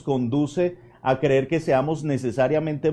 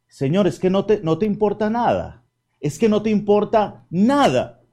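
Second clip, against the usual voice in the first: raised, higher whining reproach at the Lord; loud aggrieved complaint, voice pitched up; reproachful complaint; 7 semitones higher, 5 dB louder than usual. Acted. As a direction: scold him like a hurt, overworked hostess. Martha complaining to Jesus.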